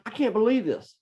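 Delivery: high-pitched exasperated outburst; losing it. Retold or imitated. Dad as his daughter starts crying, losing it. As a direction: exclaim in disbelief, voice jumping way up. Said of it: "I kinda lost it".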